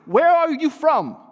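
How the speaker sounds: loud, high, urgent question; unsettled demand. A narrator's reading. Pilate questioning Jesus after his wife's warning, unsettled demand. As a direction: fire it out, rattled and demanding an answer. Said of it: "quite unsettled".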